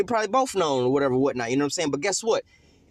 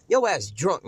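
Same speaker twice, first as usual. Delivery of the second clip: ordinary talk, nothing marked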